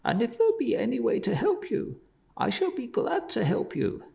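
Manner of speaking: high, pleading man's voice; kind merchant. A narrator's reading. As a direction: coax her gently from up high. Man comforts weeping woman.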